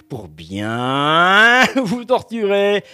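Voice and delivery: slow gloating line with a laugh; mock evil laugh. Spoof astrologer taunting the listener with a slow laugh. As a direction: savour the torture, slowly, laughing.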